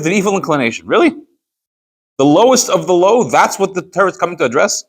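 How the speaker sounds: high-pitched, disbelieving rhetorical questions; incredulous objection. Acted. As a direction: argue it like it makes no sense at all. Argues an incredulous objection to the teaching.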